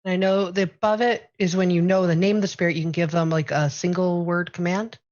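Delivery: normal talking voice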